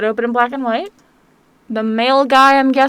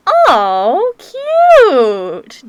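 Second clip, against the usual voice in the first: gushing; 6 semitones higher, 6 dB louder than usual. Real, not acted. Reacting to a cute picture.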